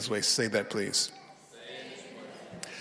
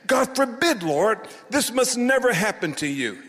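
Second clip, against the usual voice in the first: high, loud indignant protest; acted urgent scolding; sharp reproof; 9 semitones higher, 4 dB louder than usual. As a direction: rebuke him sharply, voice raised. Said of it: "and charge him sharply".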